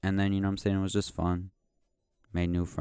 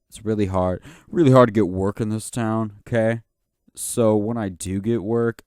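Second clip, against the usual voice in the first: louder, pressing hard on each really; loud rant; 3 semitones higher, 8 dB louder than usual. Real, not acted. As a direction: vent about how tough the work is. Ranting about getting acting work.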